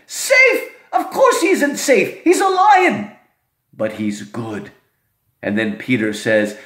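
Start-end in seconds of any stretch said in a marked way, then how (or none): 0.1-4.7 indignant beaver. Acted. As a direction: scoff at the question, then turn tender. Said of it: "Mister Beaver answers".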